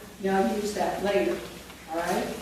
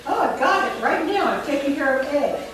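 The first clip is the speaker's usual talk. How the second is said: higher, louder burst of realisation; pupil's confident thought; 5 semitones higher, 4 dB louder than usual. Acted. Teacher voices what pupils should think, eager and pleased. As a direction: pipe up as a pupil who's got it. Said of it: "I want them to think".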